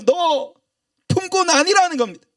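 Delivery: high and loud, cutting the son off; loud fatherly insistence. Acted. Father overrules his son's plea, loud and high. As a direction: declare it firmly over his protest.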